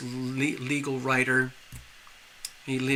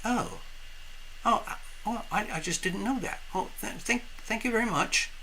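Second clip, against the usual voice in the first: higher, soft, halting little voice; humbled little fish; 5 semitones higher, 8 dB quieter than usual. Acted. Little fish thanking the snorkeler, soft, high and humbled. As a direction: stammer it softly, high and small. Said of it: "The little fish said".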